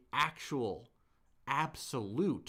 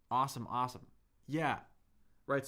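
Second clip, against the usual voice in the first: usual speaking voice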